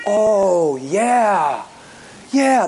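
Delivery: drawn-out acknowledgement, imitating a caller; slow retold admission; imitated realization. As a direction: stretch it out as it dawns on you. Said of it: "That's how she said it".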